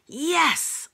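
put-on high, gleeful child's cheer; delighted exclamation. A narrator's reading. Boy hearing of free dinosaurs, delighted exclamation.

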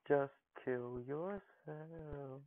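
very slow, soft, stretched words; slow, quiet drawl at the game; drawn-out coaxing. Real, not acted. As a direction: stretch each word out softly as you wait. Coaxing game opponent to fall.

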